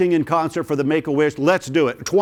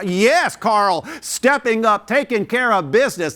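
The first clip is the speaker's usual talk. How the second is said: excited; 6 semitones higher, slower than usual. Real, not acted. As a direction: cheer the bidder on, buzzing with excitement. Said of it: "I'm getting excited here".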